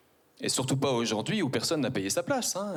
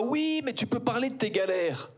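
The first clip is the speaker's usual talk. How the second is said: high, mocking objector; lightly voiced objection; heckler; 10 semitones higher than usual. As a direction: toss it in like a smug critic. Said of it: "on me dira".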